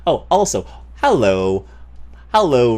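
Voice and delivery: ordinary talk, nothing marked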